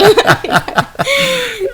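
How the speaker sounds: a burst of high, relieved laughter. Real, not acted. Mother laughing in relief at her baby's heartbeat. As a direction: laugh out the fear at once.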